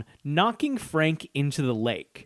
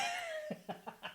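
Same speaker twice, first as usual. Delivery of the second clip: very high, quiet laughing; soft laughter; 18 dB quieter than usual. Real, not acted. Laughing at the Bulgaria quip, soft high laugh.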